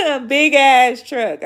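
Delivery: loud, drawn-out words through a laugh. Real, not acted. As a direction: laugh while saying it big and slow. Laughing at her own truck idea.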